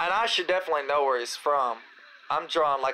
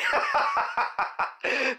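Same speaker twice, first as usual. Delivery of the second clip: laugh; 10 semitones higher than usual. Real, not acted. Laughing at his own boast.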